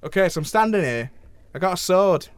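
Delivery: host playing a cheeky northern English voice actor; lightly put-on northern English accent, chatty and blunt; hammy northern English character. Host plays Nolan from North.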